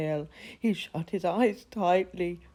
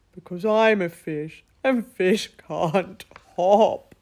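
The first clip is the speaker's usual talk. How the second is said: louder, slow upset wail; 8 dB louder than usual. Acted. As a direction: whine it out, close to tears. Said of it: "wailed Gorf".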